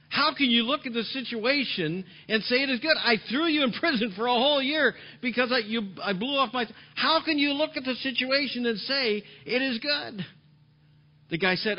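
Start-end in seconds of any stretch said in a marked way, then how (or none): 0.1-10.3 raised, loud, exasperated questioning; king demands how prison could be good, loud and high; indignant king. Acted. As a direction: hurl the question back at him. King berates his friend.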